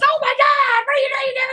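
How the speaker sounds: hammed-up screaming outburst, very high and loud; exaggerated high-pitched freak-out; over-the-top reaction. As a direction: shriek it in mock disbelief. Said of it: "That's my full reaction".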